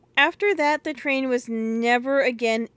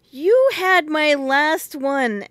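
indignant; 5 semitones higher, 7 dB louder, slower than usual. Acted. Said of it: "said Phyllis indignantly".

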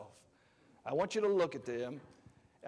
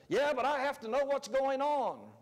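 mock whiny objection; 8 semitones higher than usual. Voicing a news-hooked listener.

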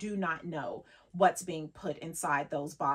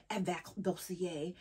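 French accent; 7 dB quieter than usual. Shown as a demonstration. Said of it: "with a French accent, it becomes fancy".

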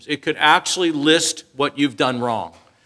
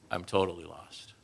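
sheepish admission; 8 semitones lower, 15 dB quieter than usual. Retold or imitated. Admitting being lost.

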